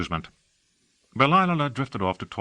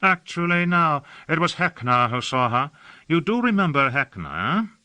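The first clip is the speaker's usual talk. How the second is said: higher, airy, languid society gentleman; affected aristocrat; 8 semitones higher than usual. A narrator's reading. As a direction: gush about the city like a bored aesthete. Hawke chatting.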